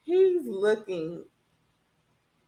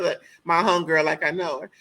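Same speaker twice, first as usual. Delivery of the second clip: ordinary delivery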